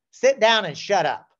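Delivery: stern, loud classroom order; mock teacher's loud order; mock command. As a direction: bark it like a strict teacher.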